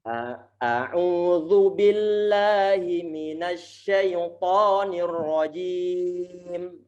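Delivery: very slow chanted recitation. Shown as a demonstration. Teacher models the taawudz for students to follow. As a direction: chant it slowly with long vowels.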